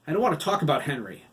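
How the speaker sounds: loud, fast snap; snapped. Acted. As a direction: bite the words off angrily. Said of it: "Hiram snapped".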